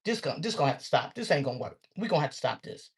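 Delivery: low, soft and firm; firm quiet adult voice. Retold or imitated. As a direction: calmly lay down the law. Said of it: "be an adult and say".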